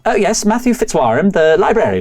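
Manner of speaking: a hint of a chatty village librarian welcoming guests; lightly voiced friendly librarian.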